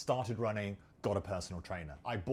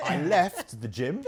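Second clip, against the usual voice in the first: laughing; 5 semitones higher, 7 dB louder, slower than usual. Real, not acted. Laughing at own embarrassment.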